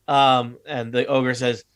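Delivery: ordinary talk, nothing marked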